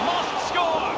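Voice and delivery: rising, urgent "must score" cry; urgent call. Acted. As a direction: push it up high with hope. Commentator urging on attack.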